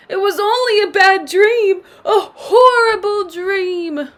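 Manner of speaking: put-on high, loud crying baby babble; babbling like a baby. A narrator's reading. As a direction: whimper it like a scared baby. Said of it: "babbling like a baby".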